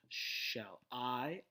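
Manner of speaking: "shall I" with I stretched out and emphasized; slowly, leaning hard on "I"; stress on I. Shown as a demonstration. Lecturer scanning the sonnet's first line, stressing I. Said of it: "I is emphasized".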